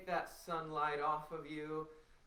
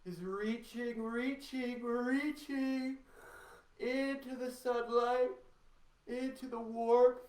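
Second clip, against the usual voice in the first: climbing higher and louder on each reaching; reaching upward; 10 semitones higher, 6 dB louder, slower than usual. Shown as a demonstration. Teacher coaxing the class to reach into the sunlight, voice climbing higher. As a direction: stretch the voice up toward the sun. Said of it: "can you imagine that your body is reaching".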